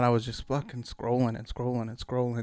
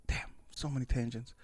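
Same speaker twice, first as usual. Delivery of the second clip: breathy whispered aside to self; whispering; 6 dB quieter than usual. Real, not acted. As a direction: whisper it under your breath, amused. Whispered aside after laughing.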